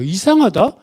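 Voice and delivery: high-pitched, retold puzzlement; puzzled wondering. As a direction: wonder aloud, voice lifted high. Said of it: "이상하다라고 궁금했대요". (높은 목소리로 의아해하듯)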